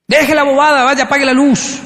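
a cranky grandpa cutting off the nonsense; scolding grandfather. Retold or imitated. Grandfather tells the frightened boy to stop the nonsense, sharp scolding.

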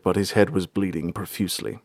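plain reading voice

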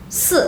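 "S" with a muffled, barely voiced I, short fourth tone; muted I, fourth tone. Shown as a demonstration. Teacher models the number four, muted fourth tone. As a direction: hiss "S" into a short dull vowel. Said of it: "es una I apagada".

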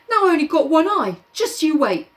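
high whiny Piggy; whiny threat. Acted. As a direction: whine the threat.

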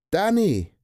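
a high, loud cry of the name; shouting. A narrator's reading. Tina shouting to her dog Danny in the cage. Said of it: "ropte hun".

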